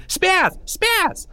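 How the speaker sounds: very high shrill yell; high yelling taunt. Acted. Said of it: "still yelling".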